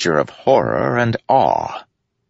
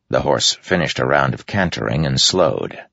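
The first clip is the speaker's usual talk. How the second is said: ordinary delivery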